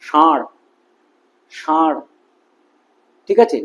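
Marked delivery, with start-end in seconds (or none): 0.0-2.0 retroflex sh at the start of "ষাঁড়"; মূর্ধন্য স. Shown as a demonstration. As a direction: say "ষাঁড়" as shar, tongue up to the palate. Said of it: "মূর্ধাতে গিয়ে লাগছে". ("ষাঁড়"-এ মূর্ধন্য ষ উচ্চারণ)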